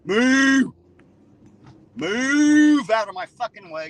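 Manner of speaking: loud, high barked yell at someone in the way; angry shout. Acted. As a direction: yell it like shoving through a crowd. Yelling insults at absent Beth.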